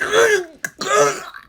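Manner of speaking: high, strangled choking cough. Acted. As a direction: gag as if your throat is squeezed shut. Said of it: "found he had difficulty breathing".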